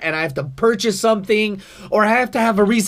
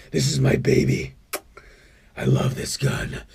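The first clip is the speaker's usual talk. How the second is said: low prepper voice sinking to a hushed, half-whispered croon over his gun; a doomsday prepper fondly showing off his rifle; off-the-grid prepper; 4 semitones lower than usual. Acted. Prepper character showing his gun to the viewers, low and doting. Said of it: "I have another guy. He's an off-the-grid guy".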